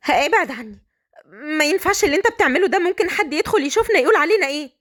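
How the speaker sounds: put-on flustered young woman objecting; protesting bride. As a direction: push him off with anxious urgency. Bride protests at being cornered.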